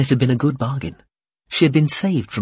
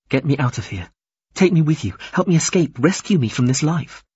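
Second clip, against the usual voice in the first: higher, insistent pleading; pitched-up urgent appeal; urgent plea; 5 semitones higher than usual. Acted. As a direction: plead to be rescued, phrase after phrase. Captive woman pleads for rescue.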